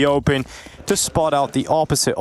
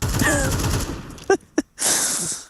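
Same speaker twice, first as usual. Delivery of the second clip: giggling laugh, high and airless; high breathy laugh; 13 semitones higher, 4 dB quieter than usual. Real, not acted. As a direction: burst out laughing. Laughs at the joke.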